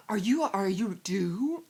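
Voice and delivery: loud, slow incredulous question; mock astonished prying; imitated nosy stranger.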